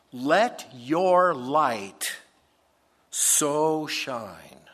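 solemn read of the Bible verses; scripture reading. Acted. Preacher reading verse thirteen aloud to the church, solemnly. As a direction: proclaim the passage with weight.

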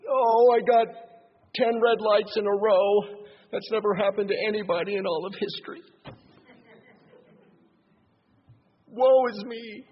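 hammed-up woe-is-me complaint; melodramatic whining. Preacher mimicking a depressed person overreacting, whiny. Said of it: "They totally over-exaggerate the problem".

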